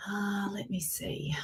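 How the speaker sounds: soft whispered aside while fiddling with the computer; muttered to herself. Real, not acted. Host whispers to herself while sorting the computer. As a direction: drop to a breathy private whisper.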